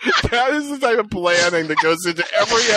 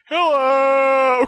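mock injured guest; 8 semitones higher than usual. Imagined injured guest greeting.

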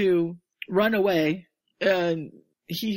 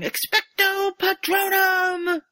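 high, slow, drawn-out incantation; Harry shouting a spell at Dementors; spell shouted; 12 semitones higher, slower than usual. Retold or imitated. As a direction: cast the spell with full force. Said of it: "Harry yelling".

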